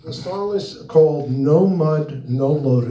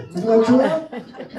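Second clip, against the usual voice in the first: laughing; 10 semitones higher, 3 dB louder, much slower than usual. Real, not acted. Teasing his friend in the room.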